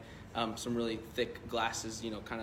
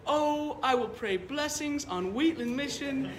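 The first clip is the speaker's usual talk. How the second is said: high, slow Chicago blessing; grateful Chicago blessing; 12 semitones higher, slower than usual. Retold or imitated. Grateful old man blesses the pastor's church in a Chicago accent. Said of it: "He said".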